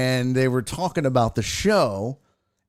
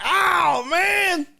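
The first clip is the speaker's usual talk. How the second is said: dismayed cry. Retold or imitated. Drunk fan finding out who was on stage, dismayed cry. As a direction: wail it like the news just hit.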